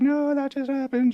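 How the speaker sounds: imitating the wife. Wife's reply in the story.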